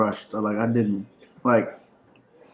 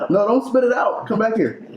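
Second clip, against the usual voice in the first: calling out; 11 semitones higher than usual. Real, not acted. Calling after child spitting food.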